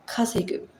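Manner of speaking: kasegu breathed out softly, unvoiced; whispered to herself. Shown as a demonstration. Practising the word quietly for herself, whispered kasegu. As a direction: murmur Kasegu under your breath to memorise it. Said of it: "it's kasegu".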